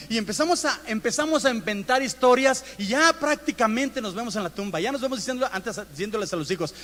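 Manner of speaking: ordinary talk, nothing marked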